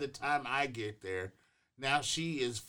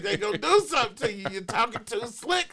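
nervous warning; 7 semitones higher, 4 dB louder, faster than usual. Retold or imitated. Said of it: "I was like".

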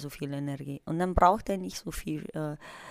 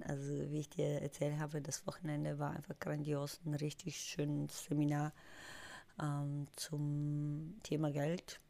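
hoarse, quiet and worn; raspy whisper; 11 dB quieter than usual. Real, not acted. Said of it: "die Stimme weg". (heisere, leise Stimme)

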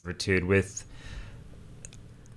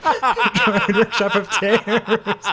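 laughing title voice; 9 semitones higher, much slower than usual. Pretend. Dramatic title naming, laughing.

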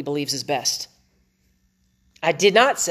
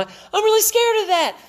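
much higher put-on voice, a bit louder; voicing the scared wife; 5 dB louder than usual. Husband playing his scared wife in a high voice. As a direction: squeak it out like a frightened wife.

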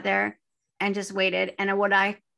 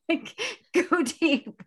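mock mystic; 9 semitones higher, much slower than usual.

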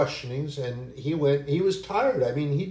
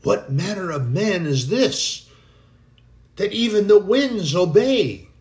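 higher and louder, in awe; amazed exclamation; 6 semitones higher, 7 dB louder than usual. Acted. Disciples marvelling at Jesus, awed exclamation. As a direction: gasp it out in wonder. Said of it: "exclamation that they made".

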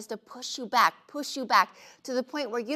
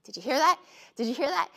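high, anxious questions; jumpy wife hearing creaks, pitched up; nervous; 5 semitones higher, faster than usual. Retold or imitated. Wife hearing creaks in farmhouse, nervous and high. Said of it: "I was like".

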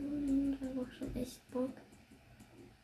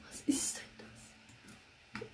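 eerie whisper; 8 dB quieter, much slower than usual. Real, not acted.

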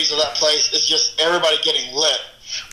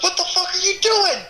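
high, incredulous mock shout; mock outraged yell. DJ imitating his friend yelling at him for stopping music, high mock outrage. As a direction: squeal it out in fake outrage.

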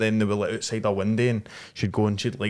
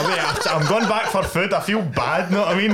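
higher, louder chirpy crow voice, laughing through it; 8 semitones higher, 3 dB louder than usual. Retold or imitated. Friend imagines the crow fetching gifts for the girl, high laughing crow voice. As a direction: play a guilty little crow bringing gifts home, giggling. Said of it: "as if the crows were going".

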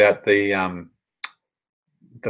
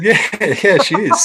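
high, laughing yeah, she is; laughing yes; 10 semitones higher, 6 dB louder than usual. Retold or imitated. Telling the Scottish couple her sister really is Anna, laughing high. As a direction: giggle through the confirmation.

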